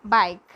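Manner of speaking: "bike" said alone and loud; pronunciation model. Shown as a demonstration. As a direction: model "bike" loudly as a single word. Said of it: "back as bike".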